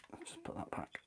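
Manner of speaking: soft unvoiced whisper. Real, not acted. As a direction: whisper it under your breath. Whispered aside while working.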